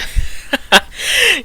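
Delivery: high, far-off character laugh bursting out; a wild, high character laugh; sudden burst of laughter. Acted. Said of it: "He bursts into sudden laughter".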